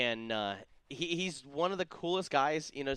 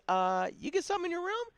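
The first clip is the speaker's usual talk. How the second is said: much higher, squeaky giddy question; mock excited question. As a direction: play it like a giddy kid on the phone. Calling buddies about hotel gift.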